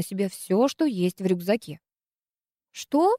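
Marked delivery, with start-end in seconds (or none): 2.7-3.1 higher, thrown off; confused what. Acted. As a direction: blurt it out bewildered. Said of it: "растерялась Руднева". (растерянное «что»)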